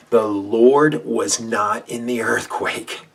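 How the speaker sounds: slow, loud, every word weighted; slow call-and-response. Shown as a demonstration. Preacher leading listeners to repeat, slow and loud. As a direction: lead the congregation word by word. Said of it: "And say it with me now".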